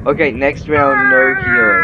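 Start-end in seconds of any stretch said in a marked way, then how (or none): 1.1-1.9 very high squeaky mock voice. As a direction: squeak it out shrilly. Announcing the no-healing rule.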